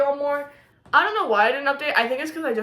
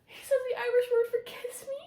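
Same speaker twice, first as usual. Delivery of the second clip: high, fast, hushed disbelief; giddy squeal of disbelief; incredulous; 12 semitones higher, 8 dB quieter, faster than usual. Real, not acted. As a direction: squeak it out in shock. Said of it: "I'm over here freaking out".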